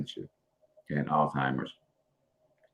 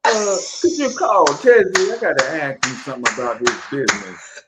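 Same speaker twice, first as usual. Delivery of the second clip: sweet-talking; 13 semitones higher, 7 dB louder than usual. Retold or imitated. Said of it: "you was giggling".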